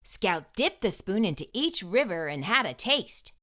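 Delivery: ordinary delivery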